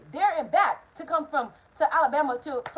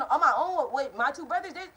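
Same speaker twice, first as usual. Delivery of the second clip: normal talking voice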